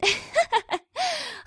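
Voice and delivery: high relieved laugh. Acted. Twilight laughing at the princesses' joke, relieved. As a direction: laugh it off, thinking it's a joke. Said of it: "began to laugh".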